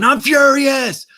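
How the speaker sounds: high, loud mock rage, wailing out the words; over-the-top fury. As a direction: imitate a furious man, way over the top. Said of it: "really over the top".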